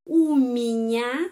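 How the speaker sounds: uminya drawn out slowly, stress on the last syllables. Shown as a demonstration. Teacher slowly modelling uminya for students. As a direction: spell out uminya slowly and clearly. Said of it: "Di sini penekanan pada huruf ya".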